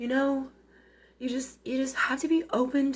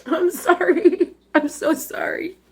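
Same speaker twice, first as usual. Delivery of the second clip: crying apology; 4 semitones higher, 4 dB louder than usual. Real, not acted. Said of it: "I'm not sorry for crying".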